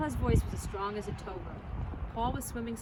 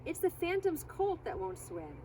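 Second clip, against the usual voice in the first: hoarse cracking voice; 8 semitones higher than usual. A narrator's reading. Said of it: "voice so hoarse it cracked".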